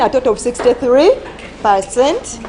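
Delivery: usual speaking voice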